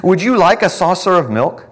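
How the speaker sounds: high, loud jeering taunts; mocking. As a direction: sneer at the captive lion. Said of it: "They mocked him, saying".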